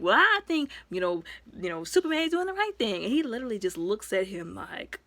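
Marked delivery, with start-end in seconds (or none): 0.0-3.0 higher, louder mock defiance; a light, perky imitation of a defiant Robin; Robin's reply. Robin defending Superman to Batman, higher and perky. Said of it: "he was standing there like".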